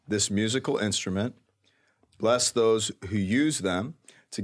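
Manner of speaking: solemn, measured prayer voice. Real, not acted. Leads a blessing prayer.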